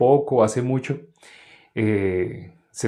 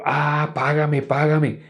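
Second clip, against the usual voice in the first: higher-pitched, lightly acted creditor's demand; creditor demanding payment; 4 semitones higher, slower than usual. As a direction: pounce on the debtor, voice lifted. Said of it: "cuando vio a su consiervo".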